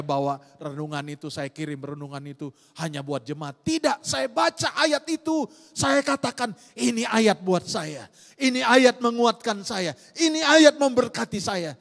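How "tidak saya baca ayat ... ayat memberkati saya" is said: high, loud preaching declaration; forceful preaching. Acted. Pastor urging the congregation, forceful preaching. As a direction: proclaim it with rising fervor.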